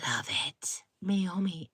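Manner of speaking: conspiratorial whisper; whispered wine tip. Real, not acted. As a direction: let them in on a secret. Secret wine tip to the girls.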